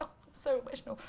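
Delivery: high, lightly put-on welling up; playful overcome-with-feeling quote; mock emotional. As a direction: gush as if moved to tears. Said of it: "still get emotional watching".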